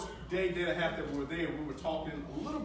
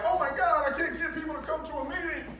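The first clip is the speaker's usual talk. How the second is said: mock complaining; 8 semitones higher than usual. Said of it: "some of you are like".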